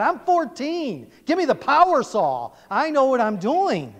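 a hint of a cocky fourteen-year-old, high and loud; mock teenage scorn. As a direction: scoff like a know-it-all teen. Voices boy's scornful reply.

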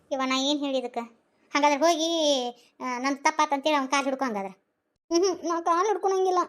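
ordinary talk

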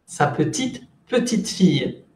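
"Sa petite" up, pause, "petite fille" down; tone contrast. Shown as a demonstration. Teacher repeating little granddaughter, rising "petite", then falling "petite fille". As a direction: lift first "petite", let "petite fille" fall. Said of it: "del tono".

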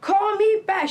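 high, loud, slow mock yell; retold yelling. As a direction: imitate the angry caller shrieking at you. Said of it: "she yelled at me".

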